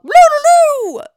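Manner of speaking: high, loud, slow sing-song trill; hammed-up high falsetto "Loo-loo-loo"; Mrs Doubtfire imitation. Missus Doubtfire look.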